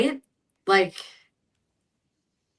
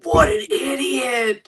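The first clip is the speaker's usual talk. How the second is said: mocking; 3 semitones higher, much slower than usual. Imagined reaction to a gaffe.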